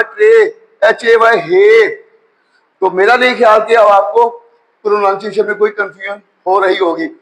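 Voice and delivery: ordinary talk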